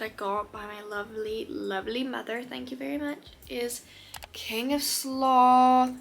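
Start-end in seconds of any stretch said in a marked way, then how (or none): 4.3-5.9 slow, louder drawn-out announcement; dramatic reveal. Real, not acted. Presenting the book to viewers, dramatic reveal. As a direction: unveil the title grandly.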